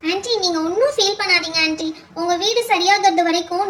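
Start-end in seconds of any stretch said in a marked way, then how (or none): none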